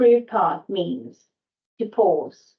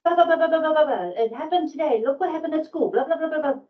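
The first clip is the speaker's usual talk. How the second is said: put-on high, rushing kid chatter babbling blah blah; excited kids; 9 semitones higher than usual. Tired parent mimicking kids bursting in after school, high excited chatter. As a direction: mimic your kids bursting in with news, pitched way up. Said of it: "you kids come running in".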